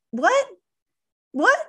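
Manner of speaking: high rising disbelief, what?; stunned, asking what in a high rise; incredulous what. Real, not acted. Preacher stunned by Esau blocking the burial, incredulous. Reacting to Esau's claim.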